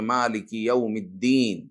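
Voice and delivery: "مالک یوم الدین" articulated carefully, with a long drawn-out "الدین"; correct recitation. Shown as a demonstration. Teacher showing the proper Fatiha, careful measured recitation. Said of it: "اس طرح، حالانکہ".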